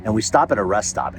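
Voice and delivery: normal talking voice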